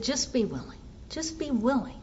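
soft repeated coaxing, just above a murmur; gentle urging. Retold or imitated. Sponsor softly urging a resentful sponsee to be willing. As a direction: ease her toward it quietly.

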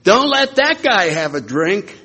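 high, slow, carrying call; a mock heckler's shout across the room; yelled across a room. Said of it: "He yells from the other side".

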